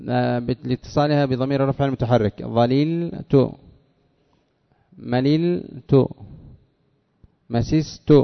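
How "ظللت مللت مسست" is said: "ظللت، مللت، مسست" slowly split before each final تُ; held sukun before ending. Shown as a demonstration. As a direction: stretch "ظللت، مللت، مسست", pausing on the still consonant. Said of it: "اللام الثانية التي سكنت". (سكون ممدود قبل تاء الضمير في "ظللت، مللت، مسست")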